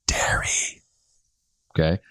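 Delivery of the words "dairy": slow, low and whispered, mock soothing. Shown as a demonstration. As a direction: breathe the word "Dairy" softly as if calming someone. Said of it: "in a very soothing voice".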